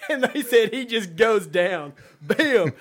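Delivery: high laugh spilling into the words; laughing hard. Real, not acted. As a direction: crack up while telling the punchline. Laughing through the punchline.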